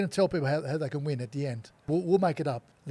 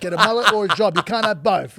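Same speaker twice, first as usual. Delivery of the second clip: loud repeat; 4 semitones higher, 11 dB louder than usual. Retold or imitated. Repeats toy line loudly.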